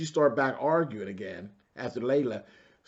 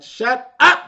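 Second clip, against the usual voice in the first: loud, high yell; shouted shut up; 12 semitones higher, 16 dB louder than usual. Retold or imitated. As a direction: blurt it out at the top of your voice. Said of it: "I was like".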